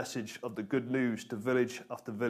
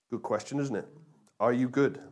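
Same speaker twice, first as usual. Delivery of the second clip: normal talking voice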